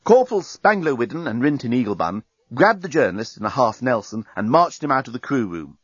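ordinary delivery

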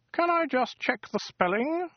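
high, precise, weaselly alien; a fussy, superior bureaucrat; prim alien voice. Acted. Wowbagger checks spelling.

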